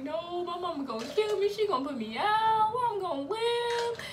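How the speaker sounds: high whining mock wail; distraught wail. Teen telling aunties mom will kill her, distraught. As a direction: draw out the last words in hammed-up despair.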